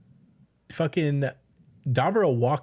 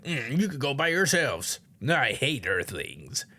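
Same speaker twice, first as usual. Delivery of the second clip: mild grumpy dismissal. Retold or imitated. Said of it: "angry guy is like".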